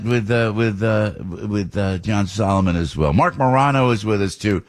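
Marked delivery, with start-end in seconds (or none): none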